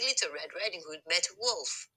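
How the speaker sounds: plain reading voice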